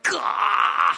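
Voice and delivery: pained gasp. Retold or imitated. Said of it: "I'm like".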